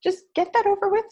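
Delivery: loud high best-friend pep on the phone; friend's voice. Retold or imitated. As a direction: play the cheerful friend who cuts through the tears. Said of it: "She goes".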